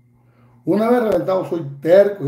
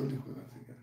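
whispered aside; 21 dB quieter, faster than usual. Real, not acted. Muttered aside in rant.

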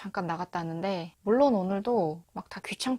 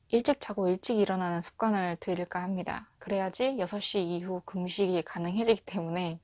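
ordinary talk, nothing marked

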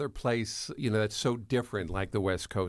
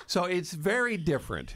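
ordinary delivery

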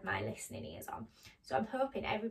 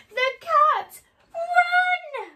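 very high, loud squeal of alarm; panicked shout; 15 dB louder, much slower than usual. Acted. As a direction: scream a storybook warning to flee. Mice see the real cat.

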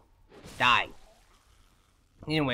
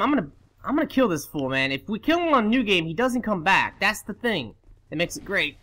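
ordinary delivery

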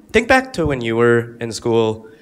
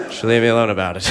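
ordinary talk, nothing marked

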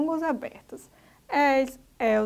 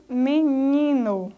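MENINO slowly split, stress on NI; penúltima sílaba tônica; 3 dB louder, much slower than usual. Shown as a demonstration. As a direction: draw out menino, leaning on the middle NI. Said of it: "Ni é a sílaba mais forte". (menino, devagar, destacando a sílaba NI)

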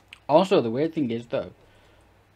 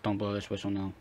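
ordinary talk, nothing marked

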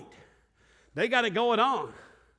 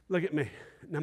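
ordinary talk

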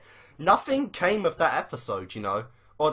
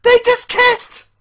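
high, loud yells, near tears with joy; disbelieving screaming; 8 dB louder than usual. Real, not acted. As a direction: scream in real disbelief, close to crying. Fan still screaming in disbelief.

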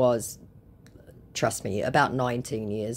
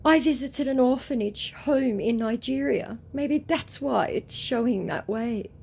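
high-pitched mock pleading; lightly put-on desperate excuse, pitched high; desperate scammer; 10 semitones higher than usual. Scammer explaining away Nigeria, mock desperate. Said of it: "said our very desperate and very disappointed".

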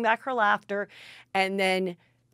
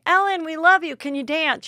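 gushing fans; 9 semitones higher, 6 dB louder than usual. Retold or imitated. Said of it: "people walk up to her and say".

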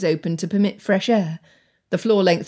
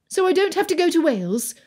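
put-on higher, pleading girl; young girl voice; 10 semitones higher, faster than usual. A narrator's reading. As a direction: voice Anne a bit higher. Anne hopeful.

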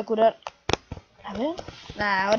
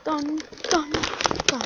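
normal talking voice

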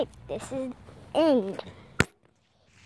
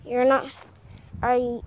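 ordinary delivery

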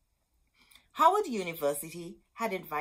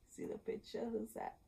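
a soft unvoiced question to the kids; hushed storyteller whisper; whispered aside; 4 semitones higher, 11 dB quieter than usual. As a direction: whisper it, drawing them into the picture. Aside to listening children.

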